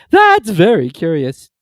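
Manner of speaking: a slow, loud, high, strange-toned turtle; curious Mock Turtle. A narrator's reading. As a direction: draw it out in wonder. Mock Turtle after long breath.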